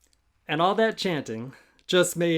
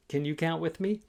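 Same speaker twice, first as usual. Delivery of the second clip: ordinary delivery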